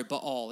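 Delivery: "Baal" as buh-ALL, stressed second syllable; corrected pronunciation. Shown as a demonstration. Preacher apologizes and corrects himself, "Baal" pronounced buh-ALL. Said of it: "is actually how you say it".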